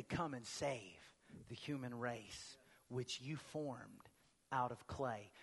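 soft, slow, hushed prayer; quiet prayer. Shown as a demonstration. Pastor models a prayer for the congregation, soft and slow. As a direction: pray it gently under your breath. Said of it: "we say".

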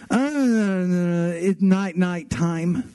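long groggy groan, then slow words; slow, drowsy kid nonsense; sleepy toddler muttering. Retold or imitated. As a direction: imitate a half-asleep child. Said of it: "he just muttered nonsense".

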